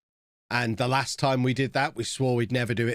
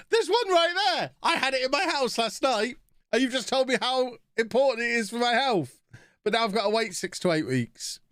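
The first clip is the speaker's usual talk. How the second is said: very high-pitched exasperated retelling; hammed-up, high indignant complaint; indignant protest; 12 semitones higher than usual. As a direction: squeal it in comic disbelief. Retold protest at being made wait.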